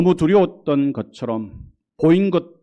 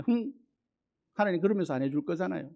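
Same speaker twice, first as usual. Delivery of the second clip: quick, pouting scoff; sulky doubt; 3 semitones higher, 9 dB quieter, faster than usual. Retold or imitated. A doubting believer sulks back at God, quick and pouting. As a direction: scoff at God like a sulking child.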